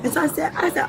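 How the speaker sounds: ordinary talk, nothing marked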